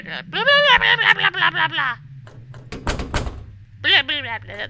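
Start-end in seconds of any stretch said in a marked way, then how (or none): 0.3-2.0 loud, high babbling that slides downward; high-pitched nonsense chatter, very loud; mock gibberish. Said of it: "they talk in gibberish here". 3.8-4.7 short loud burst of babble; imitated talking noises. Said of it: "That's their talking noises".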